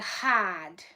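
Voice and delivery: Boston "Hard" with the R dropped, "hahd"; Boston accent. Retold or imitated. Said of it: "why do you sound like a Minecraft villager".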